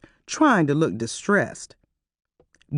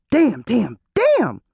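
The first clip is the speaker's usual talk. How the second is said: rising higher with each damn, near a squeal; high-pitched panic; 6 semitones higher, 3 dB louder, slower than usual. A narrator's reading. Harrison panicking into the intercom, high-pitched cursing. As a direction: lose it like a hysterical man. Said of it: "His voice was so shrill and high-pitched".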